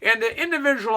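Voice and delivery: normal talking voice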